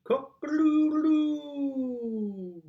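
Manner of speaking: high, slow drawn-out rooster crow. Shown as a demonstration. Showing the child Mister Brown's rooster, crowing high. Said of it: "He can sound like a rooster".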